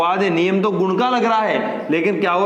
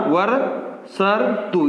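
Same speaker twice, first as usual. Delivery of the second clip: वर्षर्तु said very slowly, every sound stretched; slow teaching repeat; much slower than usual. Shown as a demonstration. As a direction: draw out वर्षर्तु for the students. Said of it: "यहाँ ऊपर र". (वर्षर्तु बहुत धीरे-धीरे)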